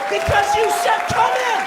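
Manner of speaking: very high, exasperated shout; angry retort. Acted. Delivery guy insists he was invited in, angry high retort. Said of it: "angrily goes".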